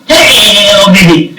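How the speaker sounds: loud, high retold cry of delight; excited shout. Storyteller re-enacts hearing the news all day, excited shouting. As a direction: holler it out like a thrilled fan. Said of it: "and I was shouting".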